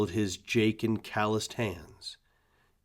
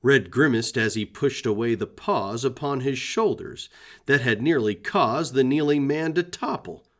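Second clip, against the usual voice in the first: ordinary delivery, nothing marked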